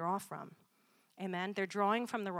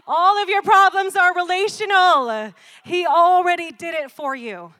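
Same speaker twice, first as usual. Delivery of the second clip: shouting and higher; preaching climax; 9 semitones higher, 16 dB louder than usual. Real, not acted. Preacher shouting to his congregation, fervent. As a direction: drive the point home at full force.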